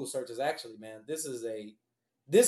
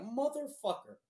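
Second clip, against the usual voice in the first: higher, strained muttered curse; exasperated curse; 8 semitones higher, 5 dB quieter than usual. Retold or imitated. Cursing at the tiny addendum, exasperated. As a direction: groan the curse in disbelief. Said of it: "And I'm like".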